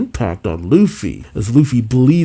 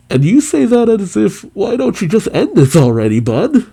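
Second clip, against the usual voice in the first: Zoro voice; 10 semitones higher than usual. Acted. Zoro taunting Lucci, voiced.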